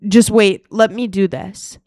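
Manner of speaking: very loud retold command; loud stepdad. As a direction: imitate the loud stepdad taking over. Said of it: "super fucking loud".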